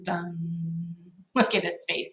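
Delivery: ordinary delivery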